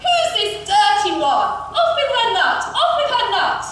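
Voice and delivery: high, loud royal outrage; prince's loud, imperious cry. A narrator's reading. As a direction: shout it as a repeated execution order. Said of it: "The prince cried".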